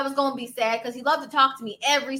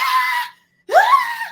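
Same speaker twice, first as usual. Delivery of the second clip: very high, loud squealing "ah"; mock screaming; 8 dB louder than usual. Reliving her scream at the spider, a high mock shriek. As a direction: shriek like you saw the spider. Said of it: "I'm like".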